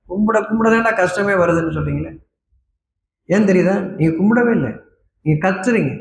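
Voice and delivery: ordinary delivery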